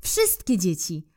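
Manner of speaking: plain reading voice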